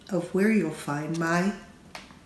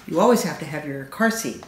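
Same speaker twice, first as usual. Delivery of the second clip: usual speaking voice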